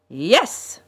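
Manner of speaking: villain's loud one-word cry; triumphant cry. A narrator's reading. Strange man reveals himself to the children, crying out. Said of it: "he cried".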